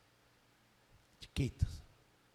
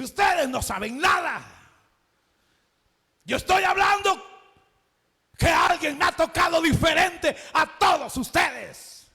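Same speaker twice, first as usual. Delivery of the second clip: loud, higher, insistent rebuke; Jesus correcting his disciples, loud and firm; forceful declaration; 6 semitones higher, 4 dB louder than usual. Acted. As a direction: set them straight with force.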